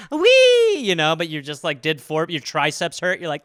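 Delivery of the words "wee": high, loud gleeful squeal; kid's delighted slide cry; mock joyful wee. Kid sliding down.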